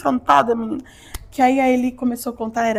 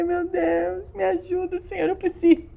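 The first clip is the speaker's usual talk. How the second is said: high mock-crying plea to God; retold tearful pleading; 7 semitones higher than usual. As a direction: beg for help as if crying your eyes out. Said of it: "morrendo de chorar". (súplica chorosa, imitando o choro)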